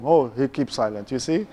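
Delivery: ordinary talk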